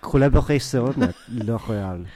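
slow, put-on French accent. Co-host naming the fancy Boston venue, in a playful French accent. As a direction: announce it with throaty French r's. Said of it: "we're speaking French".

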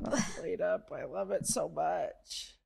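soft, slow fake whimper; mock crying. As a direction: whimper it like a sulking child. Mock crying over the game.

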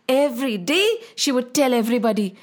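ordinary delivery

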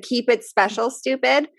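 normal talking voice

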